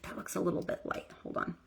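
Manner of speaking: hushed unvoiced self-talk; quiet mutter to self while fixing makeup; whispered aside. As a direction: whisper it to yourself. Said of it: "I was like".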